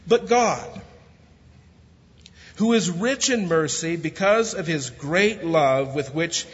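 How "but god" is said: high, loud "But God" with a pause after; dramatic preacher's pivot; emphatic turn. Acted. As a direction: lift and punch "But God".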